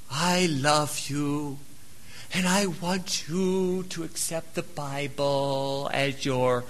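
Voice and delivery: mock the syrupy sweet talker; hammed-up soft gushing preacher; gushy mock voice. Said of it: "effeminate, ooey, gooey, gushy".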